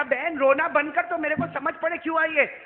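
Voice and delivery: high, loud, impatient plea; exasperated demand. Retold or imitated. Acts exasperated man to crying woman.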